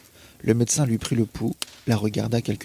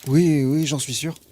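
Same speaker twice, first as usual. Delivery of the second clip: hesitant insistence; 4 semitones higher, 4 dB louder, slower than usual. Acted. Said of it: "Elle hésita quelques secondes".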